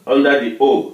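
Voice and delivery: ordinary talk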